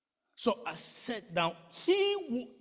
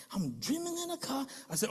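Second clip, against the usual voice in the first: ordinary delivery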